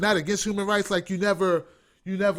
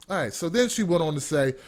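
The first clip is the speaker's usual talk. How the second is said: usual speaking voice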